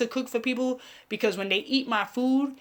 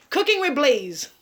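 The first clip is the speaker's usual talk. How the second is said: slow, louder announcer voice; show announcer; 6 semitones higher, 6 dB louder than usual. Acted. Vlogger announcing her cooking show name, slow announcer voice. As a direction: announce the show title grandly.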